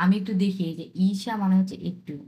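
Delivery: ordinary delivery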